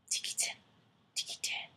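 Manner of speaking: soft unvoiced tikitin; tiny whisper sound. The mouse calls softly from the garden.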